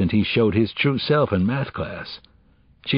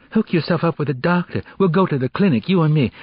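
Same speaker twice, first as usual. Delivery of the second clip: put-on higher-pitched, insistent girl giving advice; urgent teenage friend; 8 semitones higher, 3 dB louder, faster than usual. Girl urging pregnant friend to the clinic, insistent. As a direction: take charge and urge her along.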